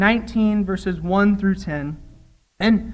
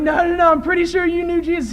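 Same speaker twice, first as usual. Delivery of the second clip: pressing accuser; 7 semitones higher, faster than usual. Retold or imitated. Accusers pressing Peter, retold.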